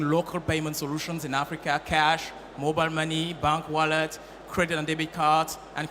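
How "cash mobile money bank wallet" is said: usual speaking voice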